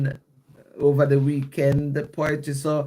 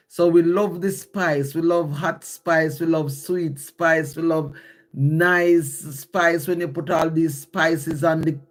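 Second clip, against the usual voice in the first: ordinary talk, nothing marked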